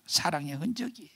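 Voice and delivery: 흔적이에요 breathed in a soft whisper; whispered repeat. Shown as a demonstration. (흔적이에요를 속삭이듯 되뇌기)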